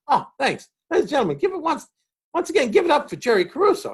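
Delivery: high, hammed-up stage announcer; mock emcee announcement. Bob hands the stage back, mock announcer. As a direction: announce him off like a cheery MC.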